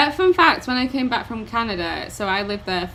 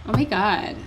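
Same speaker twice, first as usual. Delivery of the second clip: put-on lightly done Valley Girl accent; a brief stab at Valley Girl. Shown as a demonstration. Echoing co-host's American accent, light Valley Girl. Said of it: "we'll just go for Valley Girl".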